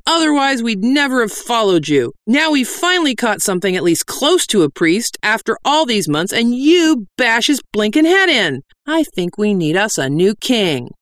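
high, indignant bandit complaint; disgruntled bandit. Acted.

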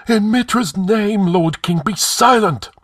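high, urgent cry. A narrator's reading. High priest hushing the king, crying out. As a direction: cry it high, slowing with dread. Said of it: "high priest of Mitra who cried out".

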